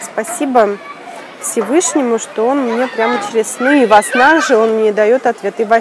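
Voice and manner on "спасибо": normal talking voice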